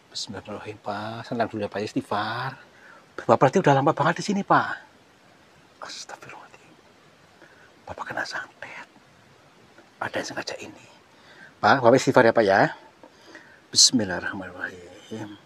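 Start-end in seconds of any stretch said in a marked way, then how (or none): 5.8-10.9 hushed, unvoiced whisper; whispering that the man was cursed. Real, not acted. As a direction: whisper the discovery fearfully. Realises a curse.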